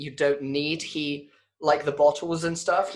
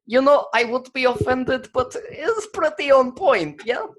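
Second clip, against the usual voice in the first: put-on Russian accent; 8 semitones higher than usual. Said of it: "He's like".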